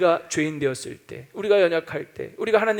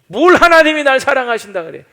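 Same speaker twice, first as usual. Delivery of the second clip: loud, high, bitter complaint; resentful outburst; 7 semitones higher, 12 dB louder than usual. Retold or imitated. Bitter believer complaining to God, loud and high. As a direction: hurl the grievance upward. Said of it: "속에서 올라오는 게 있는 거죠".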